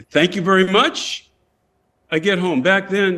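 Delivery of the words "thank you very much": high, drawn-out sarcastic thanks; slow, irritated mimicry ending on a rise; pissed off. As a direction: mockingly echo the brush-off with rising indignation. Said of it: "Now I'm pissed".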